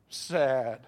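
low, drawn-out mock sad; attempted sad. Preacher trying to act out the disciples' mood, mock sad. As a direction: try and fail to sound sad. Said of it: "I'm trying to be sad".

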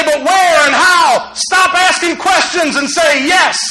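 slow, loud, high preaching shout. Real, not acted. Preacher shouting at the congregation to stop asking questions, slow and loud. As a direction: hammer it home to the church.

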